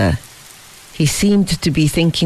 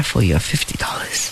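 half-whispered refusal; 8 semitones lower, 6 dB quieter than usual. A narrator's reading. Fabian refuses the money.

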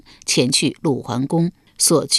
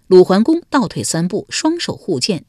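ordinary delivery, nothing marked

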